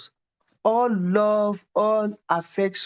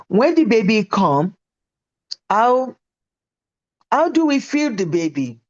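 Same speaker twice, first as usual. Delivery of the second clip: put-on louder, higher, curious little girl pressing her question; eager child's question; 3 semitones higher, 5 dB louder than usual. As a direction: ask it like an impatient child. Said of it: "She said".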